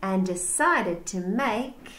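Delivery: lively storybook read-aloud; reading to children. Acted. Teacher reading Wombat Stew aloud to the class. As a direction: tell it to wide-eyed kids.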